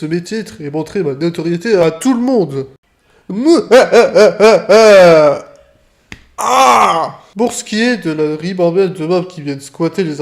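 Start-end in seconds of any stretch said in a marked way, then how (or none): 3.3-7.2 loud villain cackle, rising up; evil laugh. Acted. Gloating over his titles.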